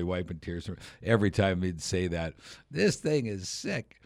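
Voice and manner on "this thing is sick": high, slow imitation of slang; twenty-year-old slang. Actor repeating his scripted sled line, slow mock youth slang. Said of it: "like a twenty-year-old would say".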